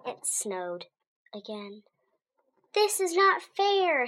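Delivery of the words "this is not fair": high, slow whining complaint; groaning complaint. A narrator's reading. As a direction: drag it out like a fed-up kid. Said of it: "Harry groaned".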